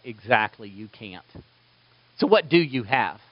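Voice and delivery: lightly voiced patient teacher; calm Jesus. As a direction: answer gently and knowingly.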